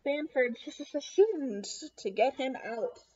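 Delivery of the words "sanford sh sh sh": plain reading voice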